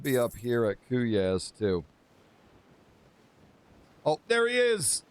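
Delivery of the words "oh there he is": excited shouting. Real, not acted. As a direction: cheer like the big moment arrived. Thrilled to meet the Sultan.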